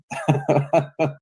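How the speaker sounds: high laugh at own jibe; mocking laugh. Real, not acted. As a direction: laugh at them after the jab.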